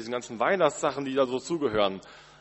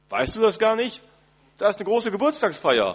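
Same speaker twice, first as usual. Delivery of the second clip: higher, louder, breezy partygoer voice; party guest; 8 semitones higher, 5 dB louder than usual. Pretend. Partygoer tells the birthday child about the feast, breezy and louder. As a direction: toss it off like a guest leaving a party.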